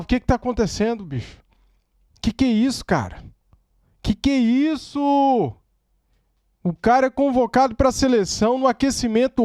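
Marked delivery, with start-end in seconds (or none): 4.0-5.5 slow, stretched-out disbelief; drawn-out disbelief. Real, not acted. Fan reacting to terrible injury news, drawn-out disbelief. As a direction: drag the words out in disbelief.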